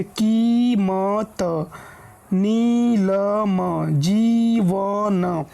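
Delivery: very slow कीमत, नीलम, जीवन with ī stretched; long ī held. Shown as a demonstration. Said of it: "कुछ ज़्यादा समय लगेगा".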